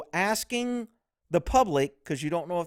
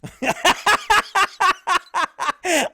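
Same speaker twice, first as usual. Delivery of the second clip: big burst of laughter; bursting out laughing at the clip; loud laugh; 12 semitones higher, 6 dB louder than usual. Real, not acted. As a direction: crack up loudly. Reacting to bodycam clip.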